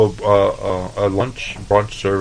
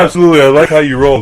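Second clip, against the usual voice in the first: eager reply; 6 semitones higher, 10 dB louder, faster than usual. Retold or imitated. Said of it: "I was like".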